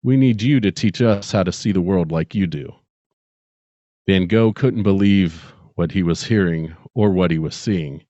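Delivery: ordinary talk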